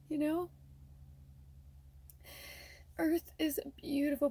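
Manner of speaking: high, soft tearful voice; crying softly. Real, not acted. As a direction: speak gently through tears to her younger self. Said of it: "I'm wiping my tears".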